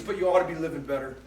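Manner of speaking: ordinary talk, nothing marked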